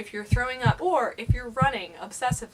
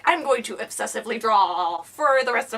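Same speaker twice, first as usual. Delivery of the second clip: louder mock declaration; mock obsessive outburst; 6 dB louder than usual. Vlogger mimicking her intrusive thought, mock outburst.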